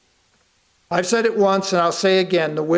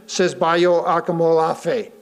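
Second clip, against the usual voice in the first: ordinary talk, nothing marked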